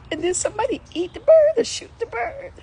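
very high, crying voice; real high-pitched upset; tearful distress. Real, not acted. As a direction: whimper it high through tears. Tearful distress over dead bird.

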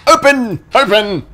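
high, loud order; shouted command. Real, not acted. Gamer ordering a locked door to open. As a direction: yell at it to open.

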